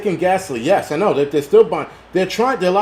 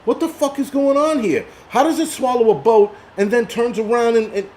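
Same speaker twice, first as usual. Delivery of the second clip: much higher, baffled outburst; exasperated disbelief; 9 semitones higher than usual. Retold or imitated. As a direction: ask it like you can't believe the screen. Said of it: "I'm like".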